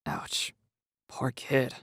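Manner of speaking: plain reading voice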